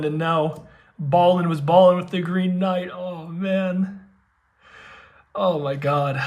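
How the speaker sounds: normal talking voice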